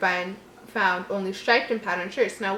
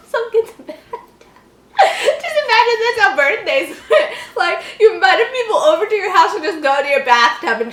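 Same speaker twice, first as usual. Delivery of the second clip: high, loud laughing; 9 semitones higher, 8 dB louder than usual. Real, not acted. Reader laughing at the kid's bathtub party. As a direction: crack up at the bathtub typo.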